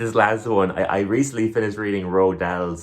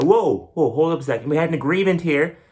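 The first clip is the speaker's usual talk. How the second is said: higher, louder indignant whoa; mock outraged protest; 7 semitones higher, 4 dB louder than usual. Comic cinemagoer protests an ad during trailers, mock outrage. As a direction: protest a broken deal, hammed up. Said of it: "I was like".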